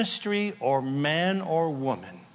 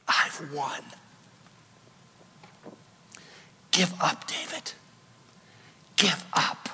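hushed sinister taunt; 5 dB quieter than usual. Acted.

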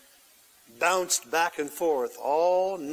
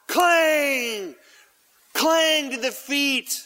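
metal clang; 7 semitones higher, 4 dB louder, much slower than usual. Acted. Hammer blows on the nails.

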